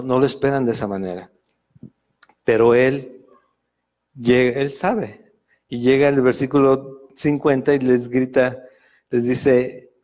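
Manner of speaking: ordinary delivery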